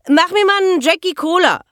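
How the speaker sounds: fast, high, loud shout; bellowed drink order. Acted. Girl ordering from Ronny over the noise, bellowing. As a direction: yell the order across the bar. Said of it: "brüllte sie lautstark".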